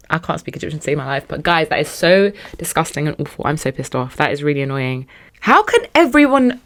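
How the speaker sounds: ordinary talk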